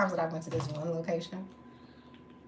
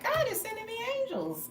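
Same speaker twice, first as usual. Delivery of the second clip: much higher, airy, awestruck; amazed grateful gasp. Retold or imitated. Telling her audience about the strangers who helped, in a high awed voice. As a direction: lift it up like a little miracle. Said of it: "I was like".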